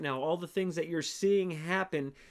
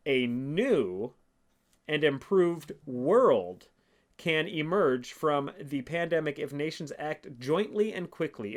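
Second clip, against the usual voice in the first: slow, each phrase spaced out, world louder; slow, weighty reread; 3 dB louder, slower than usual. Shown as a demonstration. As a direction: drag it out so every phrase lands. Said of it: "let's read this one more time for you".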